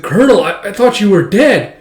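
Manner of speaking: high, loud disbelief; stunned outburst, slowing at the end; acted shock. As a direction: act floored by a return from the dead. Retold reunion with man thought dead.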